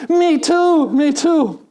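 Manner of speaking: high, eager agreement; eager me too. Retold or imitated. Preacher telling a joke, eagerly agreeing with the jumper. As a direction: chime in eagerly, delighted.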